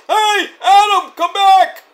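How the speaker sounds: high, loud mock holler; hammed-up drawn-out plea; imitated calling out. Acting wrestler calling after departing friend.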